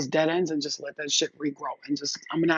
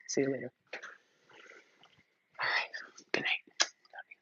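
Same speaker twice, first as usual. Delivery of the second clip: whispered good night, nearly silent; hushed whisper to sign off; whispered goodbye; 3 semitones lower, 11 dB quieter than usual. Real, not acted. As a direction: breathe it out under the voice. Softly signing off.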